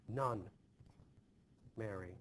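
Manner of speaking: usual speaking voice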